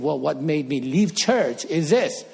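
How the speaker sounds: normal talking voice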